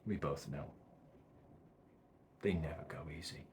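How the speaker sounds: low, very soft, resigned film monologue; hushed and deep, with long heavy pauses; doomed man's monologue. Acted. Performs Equilibrium monologue to camera.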